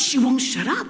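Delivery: high-pitched, insistent complaint; exasperated protest. Retold or imitated. Father excusing his yelling at the baby to the mother, exasperated protest. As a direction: imitate a frazzled plea, pitched well up. Flustered father defends himself, retold.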